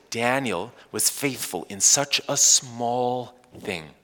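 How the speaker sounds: slow, measured emphasis; slow and deliberate. Real, not acted. Preacher asking congregation to hear this carefully, slow and deliberate. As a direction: say it slowly so each word lands. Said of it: "I'm gonna say this slowly".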